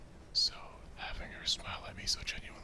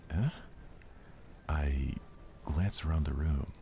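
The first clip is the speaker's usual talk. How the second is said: ordinary talk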